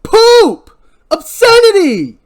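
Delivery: high, full-volume cursing scream; explosive, drawn-out furious yell. Acted. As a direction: scream the curse with all your force. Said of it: "Barry responds by yelling".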